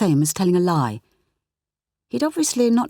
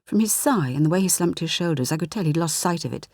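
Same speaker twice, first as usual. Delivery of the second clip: ordinary delivery, nothing marked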